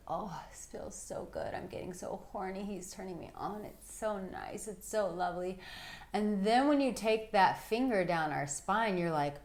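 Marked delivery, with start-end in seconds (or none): 0.1-5.6 much softer, sighing pleasure; softly voiced woman in bliss; breathy pleasure. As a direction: murmur it like a woman melting. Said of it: "We're just like".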